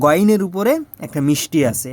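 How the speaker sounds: normal talking voice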